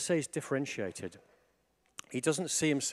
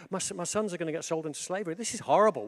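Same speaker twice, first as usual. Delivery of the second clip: higher, fast, imitating a panicked widow; mock panic; 6 semitones higher, 4 dB louder, faster than usual. Widow fearing her sons' slavery, mock panic. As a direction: play the widow's panic lightly. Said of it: "the panic".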